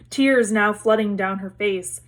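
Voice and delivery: ordinary delivery, nothing marked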